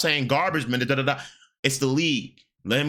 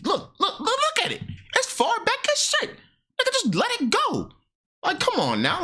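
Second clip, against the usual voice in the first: pitched-up exclaiming at the clip; high, excited pointing it out; excited high rant. Real, not acted. Streamer yelling at chat about a hairline, high and excited.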